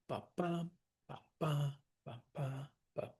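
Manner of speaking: ba ba in weak-strong pairs with short gaps; sounding out stresses. Shown as a demonstration. Teacher showing how to sound out stresses, metrical ba ba. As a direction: tap out the meter on ba, ba. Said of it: "you sound out the stresses".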